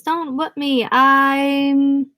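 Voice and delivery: high hammed-up caricature voice; put-on voice. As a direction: shriek it as a silly put-on. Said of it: "Richie screamed in his pickaninny voice".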